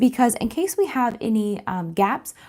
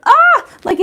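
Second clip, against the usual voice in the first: excited; 10 dB louder than usual. Real, not acted. Gushing over mint heat press.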